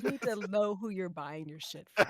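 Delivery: low, soft mock scolding; hushed, played I-told-you-so; David. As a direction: quietly lecture as the knowing friend. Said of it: "David's just like".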